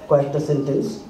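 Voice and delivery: ordinary talk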